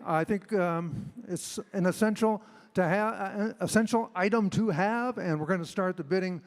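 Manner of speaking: ordinary talk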